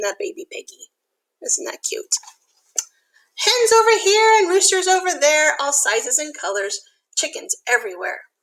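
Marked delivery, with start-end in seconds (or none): none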